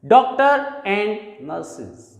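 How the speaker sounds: "doctor and nurses" slowly with the R of "doctor" linked, no R in "nurses"; slow linking demo. Shown as a demonstration. Teacher correcting students, slowly modelling "doctor and nurses". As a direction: drag out "doctor and nurses", link the first R, drop the second. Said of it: "इसे हम nurses नहीं कहेंगे".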